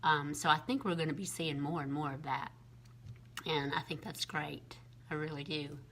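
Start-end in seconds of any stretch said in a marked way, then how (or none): none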